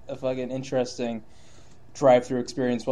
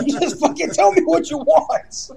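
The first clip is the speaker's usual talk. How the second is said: much higher, louder and slower, laughing; mock exasperated yell; 12 semitones higher, 10 dB louder, slower than usual. Fed-up husband yelling at his indecisive wife, laughing mock yell. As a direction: shout it out in exasperation through laughter. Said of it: "exasperated husband".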